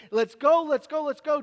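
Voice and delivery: loud, rising let's go; frantic father hurrying Jesus; urgent repeat. Acted. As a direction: push him out the door. Repeated urging.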